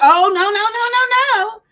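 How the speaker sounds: high, loud mocking no no no; mock protest. Imitating rude caller's loud high protest. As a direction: ham up the caller's angry no. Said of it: "and she was like".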